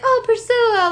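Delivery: put-on high cooing baby talk. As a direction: coo it like talking to a puppy. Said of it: "like, in, like, a baby voice".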